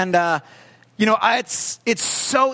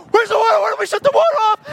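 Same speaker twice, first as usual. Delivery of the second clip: high, loud, frantic reenacted yelling; panicked; 5 dB louder, faster than usual. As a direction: shriek it fast in unmanly panic. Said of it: "you know when you get panic and you do not look pretty or manly".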